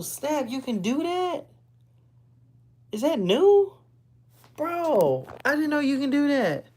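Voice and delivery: genuinely thrilled by a new discovery; gasping with delight at a new trick; real surprise. Real, not acted. Discovering the green screen feature.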